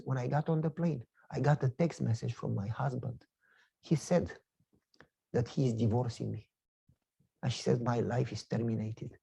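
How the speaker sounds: quietly voicing a grieving woman's words; sad lady. Crying lady telling preacher her husband is divorcing her, hushed and low.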